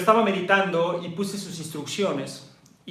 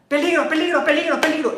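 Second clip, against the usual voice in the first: high, louder panicky alarm call; alarmed amygdala; 10 semitones higher, 4 dB louder, faster than usual. Pretend. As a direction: cry danger like a frantic alarm. La amígdala alarmada. (amígdala asustada gritando peligro)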